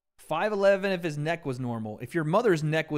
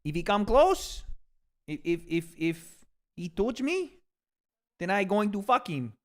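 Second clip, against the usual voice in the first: Canelo impression; 4 semitones higher than usual. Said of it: "I'm trying to sound like Canelo".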